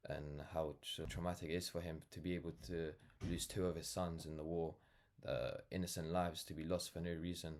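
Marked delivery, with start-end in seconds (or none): none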